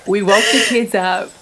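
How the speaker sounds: normal talking voice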